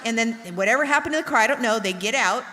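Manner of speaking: ordinary delivery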